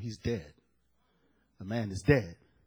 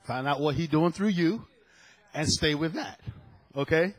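ordinary talk

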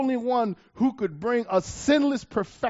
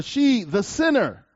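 "she, the sinner" slow and stressed; emphasis on sinner; 4 semitones higher, 3 dB louder than usual. Shown as a demonstration. Preacher reading to congregation, slowing on "the sinner". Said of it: "Emphasis added".

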